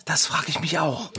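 hushed plotting; whisper. Acted. Kids whisper about Bärbel's plan, hushed. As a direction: keep your voice down.

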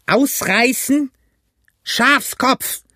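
very high, slow incredulous yell; high insulting shriek; outraged scream. A narrator's reading. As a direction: shout down a coward in scorn. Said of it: "schrie Pugh".

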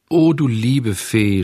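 slow, drawn-out cry of grief; mild sobbing lament. Acted. Puppet at the dead fairy's grave, mildly sobbing. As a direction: mourn her with a touch of a sob. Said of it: "schluchzte er".

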